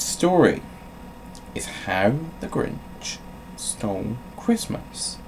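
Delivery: storybook read-aloud voice; playful bedtime story narration; reading to children. Acted. Reads Grinch story aloud as bit.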